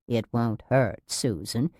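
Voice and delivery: soft, low coaxing; syrupy, quiet nurse; patronizing reassurance. A narrator's reading. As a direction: hush her like a child. Nurse coaxing patient toward injection.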